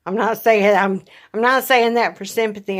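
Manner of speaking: emphatic insistence. Real, not acted.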